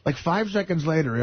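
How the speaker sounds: ordinary talk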